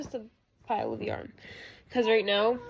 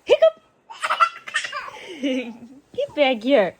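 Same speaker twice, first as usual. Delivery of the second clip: very high, loud squeals of the word between slow coos; 6 semitones higher, 6 dB louder than usual. Real, not acted. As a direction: squeak "Hiccup" up high, then coo "you're so silly" slowly. Mom teasing hiccuping baby.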